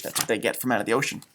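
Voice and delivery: usual speaking voice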